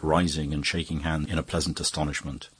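ordinary delivery, nothing marked

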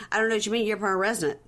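"permanent resident" mumbled with the middle of PERMANENT swallowed; imitated mushmouth. Host imitating the husband's garbled "permanent resident", mushmouthed. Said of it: "what a mushmouth he is".

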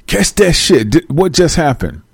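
ordinary talk, nothing marked

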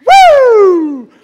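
high, loud imitated campaign yell "Woo!"; imitating Dean's woo. A speaker mimicking a politician's famous yell, high whooping shout. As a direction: belt out a whooping cheer like a crowd rouser. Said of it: "he goes".

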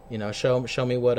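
ordinary talk

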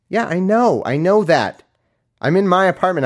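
high, impatient homeowner retort; acted exasperation. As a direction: snap back that it's obvious. Acts annoyed homeowner.

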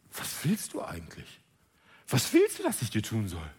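gentle teasing question, low; voicing Jesus. Jesus asks the blind man what he wants, low and soft. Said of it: "sprach zu ihm".

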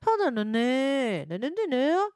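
high, lilting mock Korean. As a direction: imitate a Korean girl with soft nonsense syllables. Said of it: "girls sound more, like, softer".

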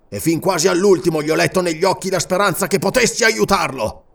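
grief turning to fury; grieving rage. A narrator's reading. King to servant, anguished then enraged.

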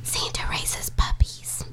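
breathless whisper, slow, full of wonder; awed whisper. Retold or imitated. A child realizing the breeder is Santa, whispered slowly in awe. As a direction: whisper it like a sacred secret. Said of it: "And I was like".

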